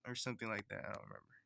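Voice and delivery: normal talking voice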